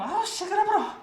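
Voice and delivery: high-pitched Gyeongsang 시끄러워라 exclamation; Gyeongsang dialect. Retold or imitated. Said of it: "귤 같은 거 먹고". (높은 음으로 경상도 사투리 "시끄러워라")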